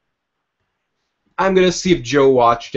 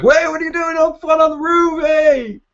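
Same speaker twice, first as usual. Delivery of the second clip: much higher, louder yelling up; alarmed shout; 5 dB louder than usual. Retold or imitated. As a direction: call out in alarm to the boy on the roof. Gordon calling up to Bruce.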